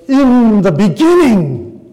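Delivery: "In the beginning" drawn out loud and high; stress on BEGINNING. Shown as a demonstration. Preacher stressing the verse's first part, "In the beginning" loud and drawn out. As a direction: preach "In the beginning" big and slow, falling at the end. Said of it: "many of them emphasize different parts".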